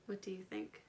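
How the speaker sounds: usual speaking voice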